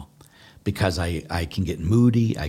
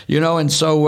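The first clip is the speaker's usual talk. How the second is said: ordinary talk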